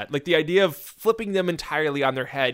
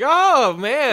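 flirty coo; 8 semitones higher, 7 dB louder than usual. Retold or imitated. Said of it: "It's like".

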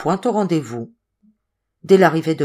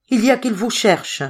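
anguish; 6 semitones higher than usual. A narrator's reading. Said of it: "exprimait l'angoisse la plus intense".

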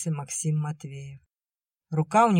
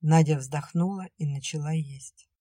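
ordinary delivery, nothing marked

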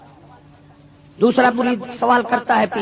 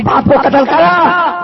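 incredulous; 10 semitones higher, 8 dB louder, slower than usual. Retold or imitated. Shocked at saint's order.

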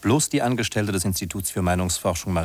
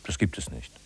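astonished softer whisper; 9 semitones lower, 7 dB quieter than usual. Acted. The narrator to himself as the couple vanishes, whispered. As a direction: whisper it to yourself in disbelief.

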